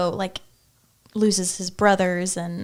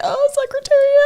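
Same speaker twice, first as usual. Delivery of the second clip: much higher and louder, bursting with recognition; excited realisation; 8 dB louder than usual. Real, not acted. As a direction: blurt it out as it clicks. Guessing the movie mid-laugh.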